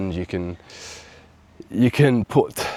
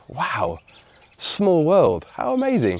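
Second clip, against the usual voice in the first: imitated wonder, climbing much higher; amazed; 8 semitones higher, slower than usual. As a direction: re-enact the captain marvelling at the coincidence. Captain amazed at shared hometown.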